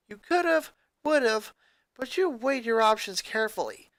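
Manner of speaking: a hint of a firm girl's scolding, then praise; firm friend. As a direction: set him straight, then reassure.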